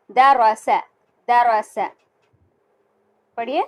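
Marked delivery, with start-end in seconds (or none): 0.1-1.9 द र स short and crisp; quick correct reading. Shown as a demonstration. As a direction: read द र स quickly, no stretching. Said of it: "इसको हम जल्दी पढ़ेंगे".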